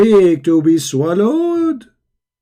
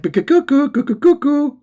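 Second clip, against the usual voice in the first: high mock cuckoo bird call; sarcastic bird chirp; 9 semitones higher than usual. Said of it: "and he did like".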